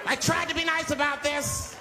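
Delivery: high-pitched exasperated warning; flipping out. Acted. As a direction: strain high like a man losing it. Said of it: "Now the guy flips out".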